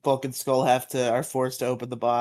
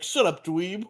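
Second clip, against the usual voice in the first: higher, swooping mock-posh voice; posh gentleman; 5 semitones higher than usual. Retelling Skull's insult to Tommy, posh gentleman voice. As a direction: imitate a haughty posh gentleman. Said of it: "his posh gentleman voice".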